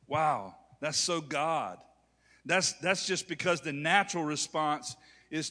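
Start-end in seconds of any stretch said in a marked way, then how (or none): none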